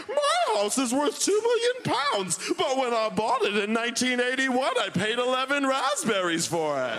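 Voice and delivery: hammed-up snooty pensioner; smug old homeowner. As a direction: boast like a smug old Londoner. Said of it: "They're like".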